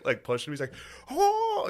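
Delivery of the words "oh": very high, louder screamed "Oh"; a hammed-up, much higher yell; mock scream. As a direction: imitate a player screaming in joy. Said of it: "He's screaming".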